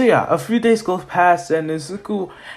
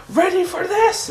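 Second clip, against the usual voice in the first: very high, eager shout-out; fired-up call. Acted. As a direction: call it out before a big throw. Hero calls before the throw.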